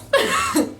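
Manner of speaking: high, fast, laughing hard; real laughter at a family memory. Real, not acted. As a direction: blurt it out while cracking up. Cracking up at the memory.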